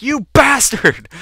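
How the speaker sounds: high, loud, drawn-out cry; outraged yell. Retold or imitated. Kid at the dad who trolls him, outraged yell. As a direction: yell it slow in mock outrage. Retold outburst at teasing dad.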